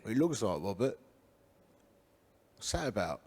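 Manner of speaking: low, hushed remark to a friend in church; hushed aside. Retold or imitated. Whispering to Biggs at the back of church, hushed. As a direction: mutter it under your breath, amused.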